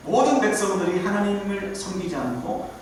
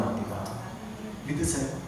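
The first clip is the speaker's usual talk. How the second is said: ordinary talk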